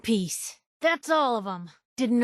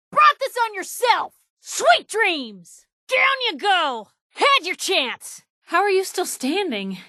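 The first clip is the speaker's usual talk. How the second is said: combat taunts; 8 semitones higher than usual. Acted. Combat taunts shouted at enemies.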